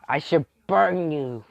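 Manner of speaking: loud, high, drawn-out threat; acted angry threat. Toya threatening the one who teased, loud acted outburst. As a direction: threaten hotly, stretching the words.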